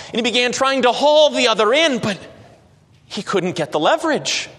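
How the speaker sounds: plain reading voice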